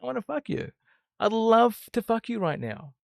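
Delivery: hammed-up seductive invitation; acted lustful invitation. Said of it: "it's how you create an invitation".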